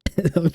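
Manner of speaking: a real amused chuckle; short laugh. Real, not acted. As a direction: laugh at his own voice. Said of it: "whatever the fuck I'm doing".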